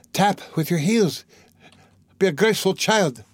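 high, broken bursts with gaps between phrases; gasping delirium. A narrator's reading. Delirious mother orders children to dance, high gasping bursts. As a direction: call out high and haltingly, as if gasping. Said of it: "gasping after each word".

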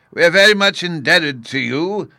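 high, loud parting thanks; grateful call. Acted. Professor being dragged away thanks the officer, calling out. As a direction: call your thanks back over your shoulder.

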